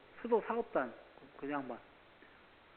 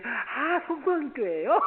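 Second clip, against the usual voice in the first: eager welcome; 10 semitones higher, 9 dB louder, slower than usual. Retold or imitated. Clerk now eager to help.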